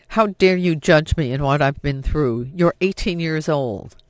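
loud, angry fatherly retort; angry outburst. Acted. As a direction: snap back at her furiously. Father erupts at daughter.

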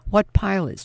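high, loud frightened gasp of a question; gasped in fear. A narrator's reading. Woman pinned to the wall gasps at her attacker in fear. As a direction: blurt it out as if caught and scared. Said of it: "she gasped".

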